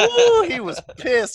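slow, high ooh with a laugh. Real, not acted. Recalling the angry dad to friends, laughing. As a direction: savour it, laughing.

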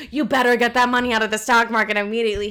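loud, urgent imitation of a panicking mother; mock parental alarm; freaking-out mom. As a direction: hammer it out as a frantic order. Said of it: "My mom starts freaking out".

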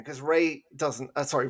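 usual speaking voice

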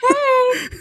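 high-pitched mock wife's greeting, half laughing; Colleen. Co-host retelling the pinned wife's greeting, high and comic. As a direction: imitate a film wife in a high, giggly voice. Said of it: "she's just like".

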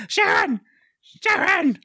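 high-pitched mock scream of a name. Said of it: "ten minutes of screaming".

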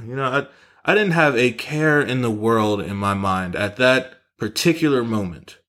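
ordinary talk, nothing marked

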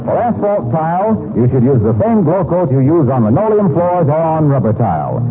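normal talking voice

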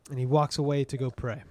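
normal talking voice

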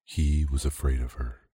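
ordinary delivery

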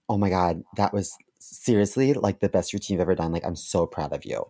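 mildly mocked gushing; gushing girl. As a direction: imitate a fawning fellow comic. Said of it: "She's like".

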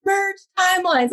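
high-pitched mock scream, slow and insistent; imitating him yelling an order; retold screaming. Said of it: "he starts screaming at me".

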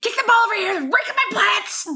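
high, laughing mock yell; retold yell. Neighbour yelling to the kid's mom, high mock yell. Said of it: "I yelled at her".

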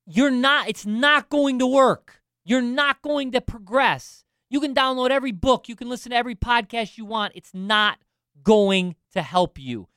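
forceful pep talk, leaning on key words; emphatic exhortation. Real, not acted. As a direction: drive each point home like a fired-up coach. Coach driving home a hard point.

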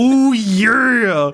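hammed-up raspy Ooh yeah; Macho Man voice. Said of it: "wrestling promo".

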